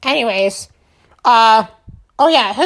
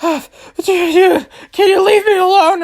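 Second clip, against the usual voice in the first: much higher, pleading whine; whiny grunt voice; 9 semitones higher, 3 dB louder than usual. Retold or imitated. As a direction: beg to be left alone. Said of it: "and he was like".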